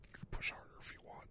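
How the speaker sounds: fast soft whisper; quick aside to the masseuse, hushed. Real, not acted. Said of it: "Yeah, right there".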